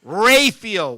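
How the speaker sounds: loud RAPHAEL as "RAY-feel"; mispronounced name. Shown as a demonstration. Man mocking others' error, Raphael as "ray-feel". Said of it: "Why did they say Raphael?".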